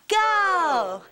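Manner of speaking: shouted cheer, leading the crowd; cheer call. Acted. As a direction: shout it to lead the room's cheer. Leading a call-and-response school cheer.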